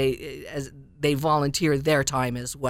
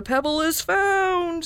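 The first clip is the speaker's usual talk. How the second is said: magic lizard; 13 semitones higher, slower than usual. Acted. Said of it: "said the lizard".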